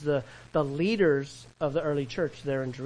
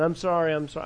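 ordinary talk, nothing marked

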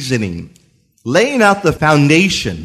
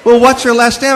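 high-pitched imitation of a mother; questioning mother; 9 semitones higher, 4 dB louder than usual. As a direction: quiz your son, pitched well up. Mother questions son, acted out.

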